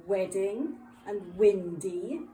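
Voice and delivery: "Wedding and windy" slowly, two beats each; two-syllable words. Shown as a demonstration. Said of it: "have two syllables".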